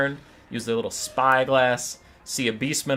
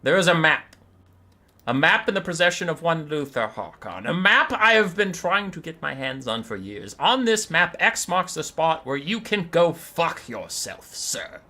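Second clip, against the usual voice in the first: raised, grand elf lord voice; Teclis; 4 semitones higher, 3 dB louder than usual. Acted. Elf mage mocks the gullible bird, lofty declaiming. Teclis roasts Malagor in character.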